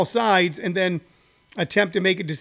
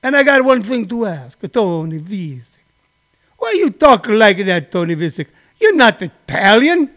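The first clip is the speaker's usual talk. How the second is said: friend Giuseppe in a hammed-up Italian-American accent, louder; mock Italian friend; 2 semitones higher, 4 dB louder than usual. Friend Giuseppe asks Tony why he talks like that, hammed-up Italian accent. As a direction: scold Tony for faking the accent. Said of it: "and he say".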